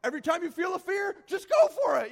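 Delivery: loud, high hyped-up mock advice; mocking a fearless risk-taker shouting advice; mock gung-ho shout. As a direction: parody a reckless motivator yelling. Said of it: "one of those persons who's like".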